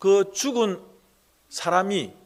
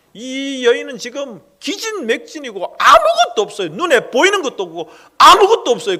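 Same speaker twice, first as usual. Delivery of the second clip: much higher and louder anguished plea; impassioned despair; 7 semitones higher, 9 dB louder than usual. Acted. Preacher crying out the widow's despair to the congregation. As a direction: preach her hopelessness at full force.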